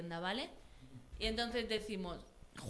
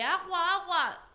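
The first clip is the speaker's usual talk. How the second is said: high, loud plea for relief; urgent cry; 7 semitones higher, 6 dB louder, slower than usual. A narrator's reading. Fox with burning mouth begs for water, crying urgently. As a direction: call out desperately, high and loud.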